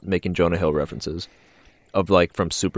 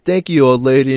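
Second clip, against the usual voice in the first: acted thanks; 7 semitones higher, 8 dB louder than usual. Acted thanks in movie scene.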